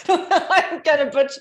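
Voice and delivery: laughing through the words at her own attempt. Real, not acted. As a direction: giggle while starting the word. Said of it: "if I try to even do an impression".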